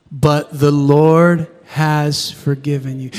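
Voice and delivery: slow, low group recitation; measured responsive reading; congregation reading. Shown as a demonstration. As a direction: read it aloud together, slowly. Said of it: "read it with me again".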